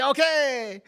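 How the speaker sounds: high, bright okay; eager acceptance. Retold or imitated. He accepts his friend's free meal, high and glad. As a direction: accept the offer gladly. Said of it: "I say".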